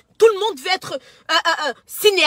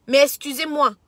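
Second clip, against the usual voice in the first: normal talking voice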